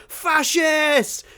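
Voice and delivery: shouted Fascist with a gun in hand; shouted insult. Retold or imitated. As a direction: yell it as she runs out with a shotgun. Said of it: "She's like".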